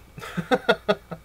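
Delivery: high, loud chuckle; short burst of real laughter. Real, not acted. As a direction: laugh it off after the remark. Laughing at own bitter memory.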